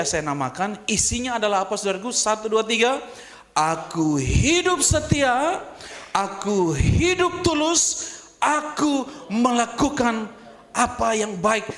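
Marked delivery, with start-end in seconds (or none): 3.5-11.7 slow emphatic prayer. Acted.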